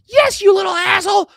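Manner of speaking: very high, loud mock shout; mock wife shouting. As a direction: yell it as the furious wife. Voicing angry wife in argument.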